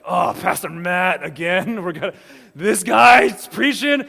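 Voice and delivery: loud, exaggerated congregation gripe; hammed-up exasperated churchgoer; mock grumbling. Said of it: "you just start grumbling".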